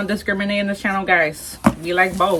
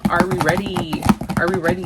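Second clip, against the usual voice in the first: normal talking voice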